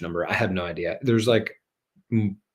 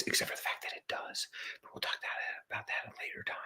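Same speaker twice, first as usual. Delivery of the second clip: unvoiced conspiratorial aside; whisper; 17 dB quieter, faster than usual. Real, not acted. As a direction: whisper it like a secret.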